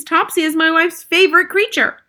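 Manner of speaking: high, loud dismay; distressed cry. A narrator's reading. Father defending his wife's cat, high cry. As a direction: protest at the top of the voice. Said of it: "cried Mister Jenkins".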